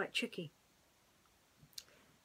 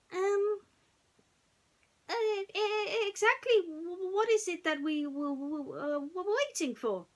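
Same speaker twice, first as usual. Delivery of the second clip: high, stammering little piglet voice; timid Piglet; 10 semitones higher than usual. A narrator's reading. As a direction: stutter it out nervously as a small pig. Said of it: "asked Piglet".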